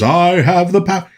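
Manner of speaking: lightly voiced He-Man line, not very heroic; a limp, unimpressive power cry; unimpressive movie He-Man. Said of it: "His voice, when he says".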